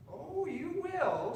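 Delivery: very high, drawn-out witch voice; sly witch laugh. A narrator's reading. Witch hiding laughter from the frog, high and drawn out. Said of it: "witch laughing up her flappy sleeve".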